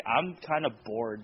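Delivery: low, slow, bored drone. Retold or imitated. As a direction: sound utterly uninterested. Quoting own bored thought.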